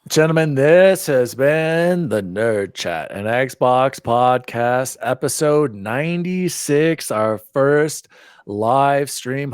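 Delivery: big, slow show-announcer delivery; announcer sign-off. Real, not acted. Host closing the podcast's first live stream, announcer style. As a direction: draw out each phrase like a ring announcer.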